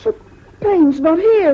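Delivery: high, loud frightened protest; desperate protest. Acted. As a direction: cry out in desperation. Boy protests before caning.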